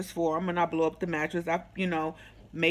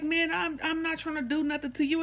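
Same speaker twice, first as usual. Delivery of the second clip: high-pitched mock protest of innocence; imitated protest; 8 semitones higher, 4 dB quieter than usual. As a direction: imitate him denying it, pitched well up.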